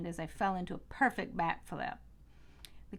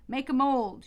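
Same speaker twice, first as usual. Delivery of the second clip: a louder order snapped at the team; shouted order; 5 semitones higher, 5 dB louder than usual. Acted. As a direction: give the order loudly. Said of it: "Starr shouted".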